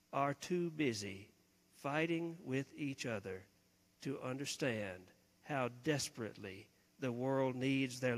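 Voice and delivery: quiet, low, measured weary parent; a tired parent patiently urging children to listen. Acted. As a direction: plead softly and wearily, pausing between phrases. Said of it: "in the voice of a weary parent".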